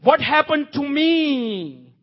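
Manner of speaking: high, drawn-out anguished question; God's anguish. Acted. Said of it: "This is God's impression".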